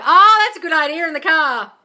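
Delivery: loud, high, swooping approval; delighted exclamation. Real, not acted. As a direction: seize on the idea with delight. Delighted at a viewer's suggestion.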